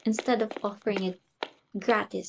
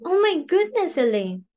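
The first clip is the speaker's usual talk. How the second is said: astonished; 8 semitones higher than usual. Acted. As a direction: burst out in amazed excitement. Realises guest is the prince.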